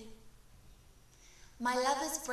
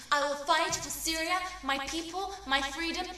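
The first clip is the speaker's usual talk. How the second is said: loud, high, defiant rallying cry; defiant shout; 4 semitones higher, 5 dB louder than usual. Acted. Poet voicing her lover screaming his vow, defiant shout. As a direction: shout it out for the homeland. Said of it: "He screams".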